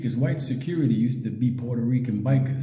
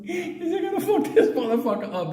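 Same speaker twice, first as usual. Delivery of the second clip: laughing; 14 semitones higher than usual. Real, not acted. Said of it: "laughing through telling".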